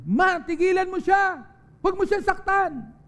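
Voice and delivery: put-on high, loud cry of a child pleading; child's desperate shout. Child begs mother to stop the beating, desperate high shout.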